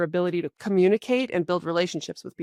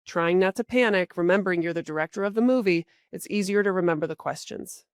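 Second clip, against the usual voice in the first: usual speaking voice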